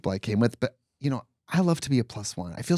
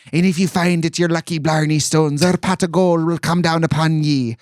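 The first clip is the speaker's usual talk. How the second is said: imitating an Irish accent; 4 semitones higher, 4 dB louder than usual. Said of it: "puts on an Irish accent".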